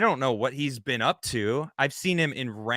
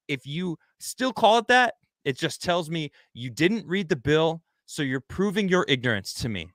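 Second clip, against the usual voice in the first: ordinary delivery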